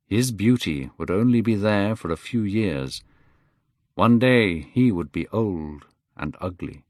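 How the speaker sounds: ordinary delivery, nothing marked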